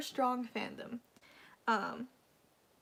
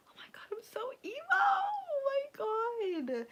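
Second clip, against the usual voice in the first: breathy, high, half-whispered emotional cry; emotional whimper; 14 semitones higher than usual. Real, not acted. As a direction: overcome by fan feelings, voice breaking. Fan crying over idol memories.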